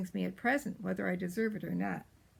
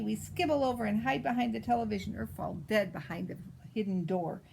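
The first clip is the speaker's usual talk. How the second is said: usual speaking voice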